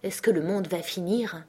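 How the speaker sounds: ordinary delivery, nothing marked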